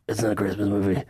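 breathy whispered mock of Michael; whispery impression of Michael. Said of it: "This is my impression of Michael".

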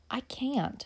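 a small, shy refusal under the breath; whispered refusal. Acted. Girl refuses the wine.

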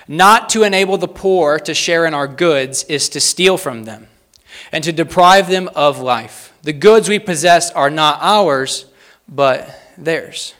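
loud, measured quote reading; slow, deliberate reading. Real, not acted. Preacher reads a church father's quote to the congregation, slow and deliberate. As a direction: read it out slowly, weighting each phrase. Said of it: "read these slowly and succinctly".

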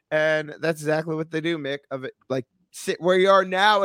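ordinary talk

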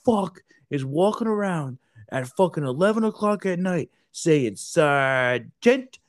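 mock angry sergeant major. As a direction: chew out the Marines. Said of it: "And he goes".

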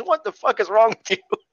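a hint of a cursing villain; imitating angry cursing. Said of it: "starts cursing and says".

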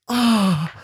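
high-pitched "oh" of release; imitated climax moan. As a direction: re-enact a moan of pleasure. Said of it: "exactly like that".

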